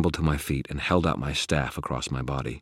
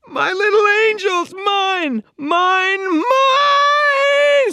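piercing, very high possessive shriek; screaming ghost; 10 dB louder, much slower than usual. Acted. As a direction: scream it out, dragging the last word. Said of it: "screamed a voice that roared".

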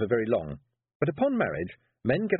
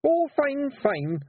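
much higher, louder nervous babble; burbling Rob; 5 dB louder, much slower than usual. Acted. Rob answering his wife, high nervous burble. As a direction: burble it out in a panic. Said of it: "Rob burbled".

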